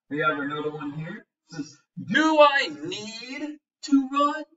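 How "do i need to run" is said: high, slow worried voice; worried question. Acted. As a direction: ask it anxiously, drawn out and high. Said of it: "asked Michael".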